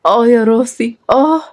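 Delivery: high-pitched groan of pain. Acted. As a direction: moan over an aching head. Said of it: "وهي ماسكة راسها بوجع".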